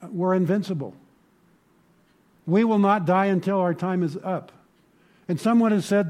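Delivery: loud, high, impassioned preaching; fervent sermon climax; full-force preaching. Acted. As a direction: preach it out to the back row.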